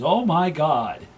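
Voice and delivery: slow, loud, drawn-out alarm; mock the doctor's shock, drawn out. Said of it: "and he goes".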